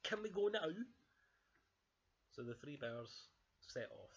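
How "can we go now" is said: high, loud, eager cub; excited Baby Bear. Acted. Baby Bear wants to leave for Bear Town, high and eager. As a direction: burst out impatiently in a small high voice. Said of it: "cheered Baby Bear".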